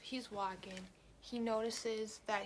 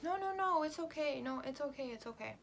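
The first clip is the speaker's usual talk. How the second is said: put-on much higher, louder pleading drunk, calming down; pleading drunk stranger; 7 semitones higher, 4 dB louder than usual. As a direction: placate her in a high pleading voice.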